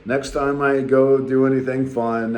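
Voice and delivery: usual speaking voice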